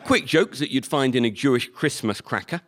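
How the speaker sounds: ordinary talk, nothing marked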